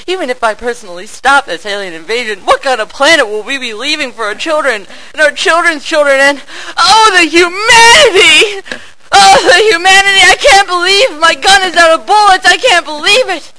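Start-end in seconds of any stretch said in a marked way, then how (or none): 3.7-13.5 high, loud mock despair; melodramatic wail. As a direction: ham up the tragedy. Melodramatic lament about planet.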